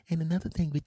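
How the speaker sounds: high imitation of overheard female chatter; high-pitched mimicked woman; mock sweet voice. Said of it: "I could hear her sweet voice".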